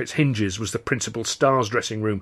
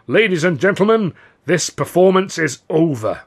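louder, higher stage announcement, slowing at the end; firm announcement; 7 semitones higher, 7 dB louder than usual. A narrator's reading. Governor ending the show before the audience, firm and loud. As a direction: declare it to the whole house, no argument. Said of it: "announced firmly".

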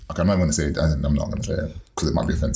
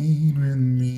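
sultry tease; 8 semitones higher, slower than usual. Pretend. Repeats seductive invite.

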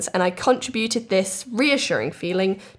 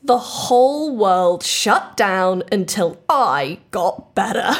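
dramatic slow reveal; slower than usual. Acted.

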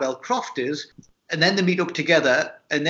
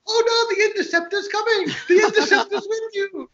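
much higher, squealing presenter imitation; shrill piercing voice. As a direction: pitch it up even higher, mock panic. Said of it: "it just gets higher and higher".

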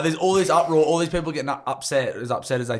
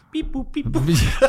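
mock robot beeps; 9 semitones higher, slower than usual. Mocking office workers' typing.

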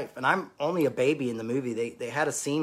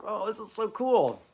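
thrilled; 8 semitones higher than usual. Retold or imitated. His own thrilled reaction.

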